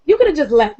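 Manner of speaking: mock disbelief. Fan scolding a TV character for what he said, incredulous.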